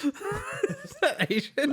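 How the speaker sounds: high laughing squeal. Real, not acted. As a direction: cackle in disbelief. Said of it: "worse than the Swedish accent".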